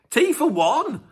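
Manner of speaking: high, disbelieving echo; can't believe what he just read; incredulous. Real, not acted. Vlogger echoing fans' pick of worst track, incredulous.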